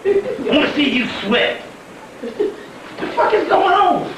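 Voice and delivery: can't believe it, cracking up while asking; retelling a call to Kimberly, laughing in disbelief. Retold or imitated. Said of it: "And I'm like".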